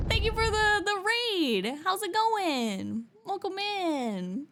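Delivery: high, bubbly streamer greeting; bright high welcome voice; cute anime streamer. Real, not acted.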